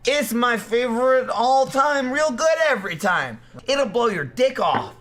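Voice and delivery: high-pitched, peppy show intro. Acted. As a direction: pitch it up high like a hype greeting.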